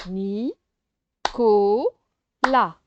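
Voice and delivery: Ni, ko, la: each syllable said separately with a pause; syllables spoken apart. Shown as a demonstration. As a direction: break the name into beats. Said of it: "on découpe oralement".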